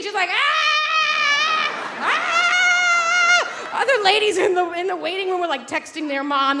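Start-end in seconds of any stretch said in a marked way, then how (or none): 0.3-3.8 high, loud imitated labor scream; retold labor scream. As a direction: belt out a mock labor scream. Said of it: "moaning, screaming".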